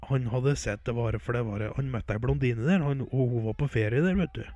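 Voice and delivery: normal talking voice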